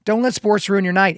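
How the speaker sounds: normal talking voice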